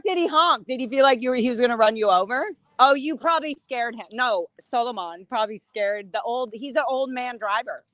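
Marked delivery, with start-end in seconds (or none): none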